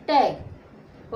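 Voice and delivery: टैग drawn out slowly after sounding ट ए ग; blended demo word. Shown as a demonstration. Teacher to students, slowly blending टैग. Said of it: "तो कैसे पढ़ोगे".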